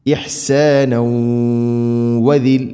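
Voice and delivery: ഇഹ്സാനൗ held in a slow nasal hum into വദിൽ; nasal merging. Shown as a demonstration. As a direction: merge ഇഹ്സാനൗ into വദിൽ with a long hummed w. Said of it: "ഇങ്ങനെ".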